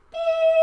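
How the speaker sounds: high, sharp beep like a washer signalling it's done; the washing machine beeps that the wash is done. Pretend. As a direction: beep high like an appliance timer.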